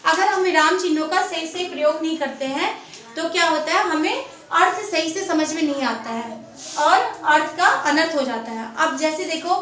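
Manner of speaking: usual speaking voice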